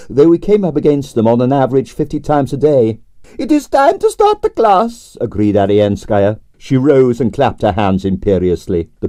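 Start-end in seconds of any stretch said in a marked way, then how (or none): 3.4-5.2 grande dame Russian ballerina. A narrator's reading. Narrator voicing Arienskaya, imperious and high. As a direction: sigh it out like a tragic prima donna.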